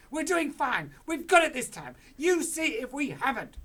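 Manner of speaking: high, loud cocky boast; Mister Cranky brags to his wife, high and loud; excited father. Acted. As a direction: crow that it will work.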